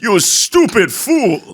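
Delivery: high, loud, drawn-out anime voice; anime villain. Acted. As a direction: stretch it out like an over-the-top anime character. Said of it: "Like an anime".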